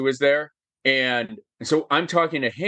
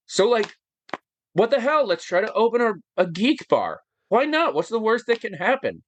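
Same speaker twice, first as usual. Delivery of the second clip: excited; 8 semitones higher than usual. Real, not acted. Excited retelling of daring attitude.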